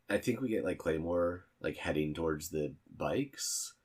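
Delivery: normal talking voice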